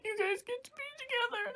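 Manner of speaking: very high, soft weepy squeak; acted tearful voice. Sam at his parents' grave, acted tearful voice. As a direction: squeeze it out softly, mock-crying. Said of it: "could have bursted out in tears".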